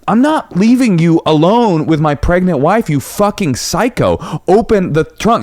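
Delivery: high, angry outraged demand; mock enraged husband at driver; mock furious retort. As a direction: retell it like seething with rage.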